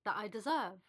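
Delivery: ordinary delivery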